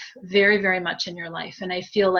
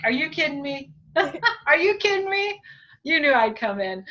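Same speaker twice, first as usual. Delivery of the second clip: rising higher, mock-incredulous; teasing disbelief; 7 semitones higher than usual. Medium voicing sister's playful disbelief, pitched up. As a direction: act amazed she doubted you. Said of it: "she just kinda sits back".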